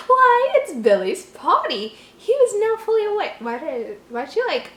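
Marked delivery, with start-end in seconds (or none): 0.1-3.4 Southern. Acted. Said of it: "Southern now?".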